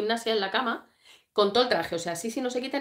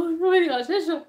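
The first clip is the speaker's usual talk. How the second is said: high, louder playful wail; mock dismay; 8 semitones higher, 5 dB louder than usual. Streamer fearing her listeners are lost, high mock-dismayed wail. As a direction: wail it in exaggerated distress.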